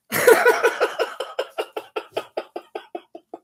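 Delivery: long wheezing cackle; laughing. Real, not acted. Laughs at his own remark.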